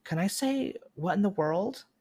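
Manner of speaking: put-on high, innocent little-kid voice; little boy checking what he may say. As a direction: ask it sweetly like a small child. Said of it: "he'll be like".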